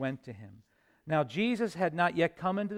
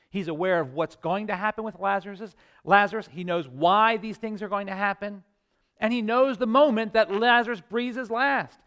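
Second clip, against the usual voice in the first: preaching louder and higher, building up; rising preaching; 5 semitones higher, 6 dB louder than usual. Acted. Preacher stirring his congregation, rising full-force preaching. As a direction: build the sermon toward its peak.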